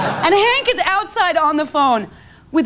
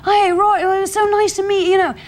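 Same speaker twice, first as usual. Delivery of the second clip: high, put-on English phone voice; lifted mock British chatter; Eddie Izzard; 7 semitones higher than usual. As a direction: imitate a friend posing as an English caller. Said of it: "it's [name] as Eddie Izzard".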